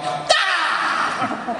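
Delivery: high exasperated yell; cracking-up scream. Acted. Man cracking under his wife's persistent calls, exasperated scream. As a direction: let out a scream as you crack. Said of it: "I crack. I'm like".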